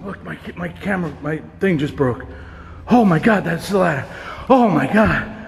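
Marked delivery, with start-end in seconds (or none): 2.9-5.4 startled exclamation. Real, not acted. Ghost hunter jumps and breaks camera gear, startled cry. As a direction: blurt it out, freshly startled.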